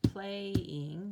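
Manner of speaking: teacher claps out "Playing" for the class; clapping out syllables. Shown as a demonstration. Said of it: "let's tap it out or clap it out".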